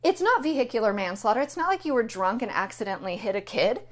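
normal talking voice

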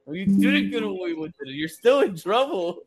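slow, pointed scolding; a parent tells a kid they're still in trouble, slowly; stern but amused. Retold or imitated. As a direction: lay down the verdict slowly. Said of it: "I'm like".

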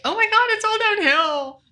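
high, dramatic mock despair. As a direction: play up the gloom, pitched high. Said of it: "I thought, like".

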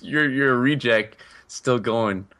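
ordinary talk, nothing marked

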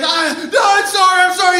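high, frantic pleading; yelped acted apology; panicked apology. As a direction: imitate a cornered kid begging forgiveness. Kid screamed at by boss.

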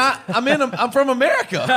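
high and loud, caught off guard; flustered protest. Retold or imitated. Traveller tells a New York airport lady he's American, flustered and high. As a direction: blurt it out, baffled at being mistaken. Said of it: "I said".

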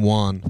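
won said as "wahn", like Juan; Juan, open ah in place of uh; Tom Segura. Retold or imitated. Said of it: "Tom Segura says fucking Juan".

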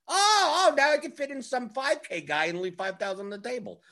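high, put-on voice for the optimizer, pleased with itself; a chirpy machine that just found a fix; mock delighted optimizer. The optimizer telling the player it can fit a cheap guy, in a high put-on voice.

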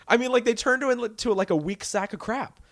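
fired up and offended for Laurie; ranting at the ruined heroine; outraged. Real, not acted. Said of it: "you see how turned up I am about this".

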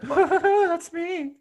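high falsetto with a giggle; giddy high-pitched roommate; maid of honor Sarah. Acted. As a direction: giggle it out in a squeaky put-on voice. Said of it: "Sarah, she's my roommate".

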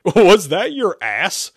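high, loud, drawn-out astonishment; incredulous question. Acted. Roommate astonished by a talking rear, incredulous. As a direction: stretch it out in disbelief.

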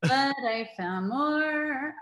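very slow, every word stretched for the laugh; slow teasing repeat of the punchline; drawn-out punchline. Real, not acted. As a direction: savour the punchline slowly.

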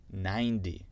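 American ninety, like nine-dee; American demo, T of ninety as D; American accent. Shown as a demonstration. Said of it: "C'était un D".

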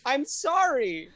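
high, drawn-out wailing apology; overwhelmed apology. Acted. Guest apologising for gushing over Paddington, overwhelmed wail.

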